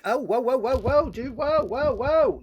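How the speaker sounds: high, loud panicked whoas; freaking out at a gun. Retold or imitated. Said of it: "I'm like".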